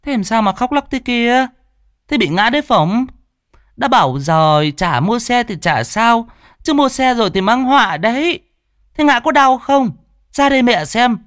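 high, loud nagging woman's voice; high shrill-free nagging mother; fussing mother. A narrator's reading. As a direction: nag like a worried mother, pitched high. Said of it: "Bà liền hỏi".

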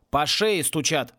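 usual speaking voice

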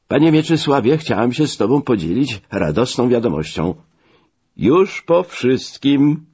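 high-pitched, elated phone voice; joyful announcement. Acted. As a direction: share good news triumphantly, pitched well up. Friend phones with joyful news. (radosna nowina wysokim głosem)

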